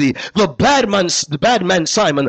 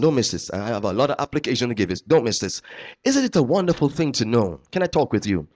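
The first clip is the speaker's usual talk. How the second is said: much lower and softer, quick confiding; hushed aside; 9 semitones lower, 7 dB quieter, faster than usual. Real, not acted. Preacher leaning in to the congregation, hushed and confiding. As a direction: drop to an intimate near-murmur.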